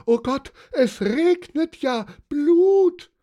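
very high, loud, panicked shriek; scream. A narrator's reading. Wife horrified by raining blood, shrieking. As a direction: scream it out, stretching the last word. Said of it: "schrie Elisabeth von Reichberg".

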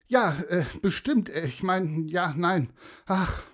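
high, halting, agitated voice; flustered stammering. Acted. Upset mother answering two strangers, stammering. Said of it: "stammelte die Frau".

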